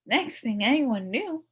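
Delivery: ordinary delivery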